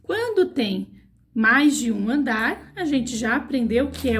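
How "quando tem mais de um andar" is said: ordinary talk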